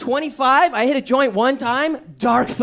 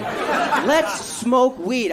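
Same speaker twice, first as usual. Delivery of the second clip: even slower drawn-out offer with a laugh; slower laughing repeat; slow stoner invite, laughing; much slower than usual. Retold or imitated. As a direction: stretch it out and grin. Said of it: "That's what the fuck he said to me".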